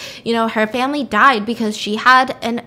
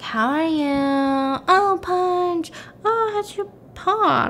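high, drawn-out cooing; cooing at pet; 7 semitones higher, much slower than usual. Retold or imitated. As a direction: coo slowly over a hurt cat. Ethan fussing over the cat.

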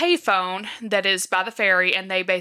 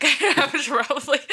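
higher, laughing through the words; 7 semitones higher, 4 dB louder than usual. Real, not acted. Podcaster clarifying which bank she means, laughing. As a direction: let a laugh break into the aside.

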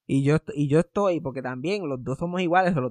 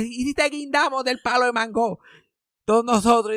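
raised, higher call across the deck; retold shout; 8 semitones higher, 4 dB louder than usual. Grandson shouting a joke on the cruise, loud. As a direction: yell it so she can hear. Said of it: "yo estoy gritando esto a toda boca".

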